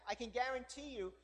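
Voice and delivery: ordinary talk, nothing marked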